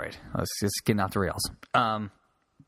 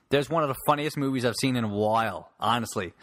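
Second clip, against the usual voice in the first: ordinary delivery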